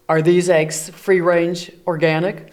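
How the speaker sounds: gruff bear voice greeting a neighbour; grumpy bear Bruce. Acted. Bear Bruce asks Missus Goose.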